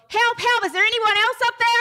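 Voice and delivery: high, loud, hurried call upward; comic desperate shout for someone else; yelling for help. Acted. Hiker rejects God's order and calls for another rescuer, yelling for help. Punchline, hiker yells past God.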